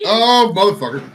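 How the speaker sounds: gasping from spicy heat; hot food pain outburst; burning mouth. Real, not acted. Eating very hot pizza.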